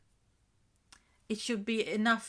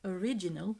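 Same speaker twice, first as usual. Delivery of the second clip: ordinary delivery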